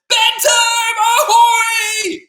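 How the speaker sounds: very high, loud singsong holler; excited octopus. Acted. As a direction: belt it out like a gleeful ship's call. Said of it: "He's so excited".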